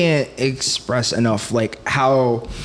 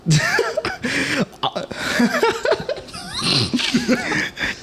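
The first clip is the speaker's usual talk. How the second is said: real cracking up, high and long; helpless high laughing fit; high-pitched laughter; 5 dB quieter than usual. Real, not acted. Cracks up at the proudly joke.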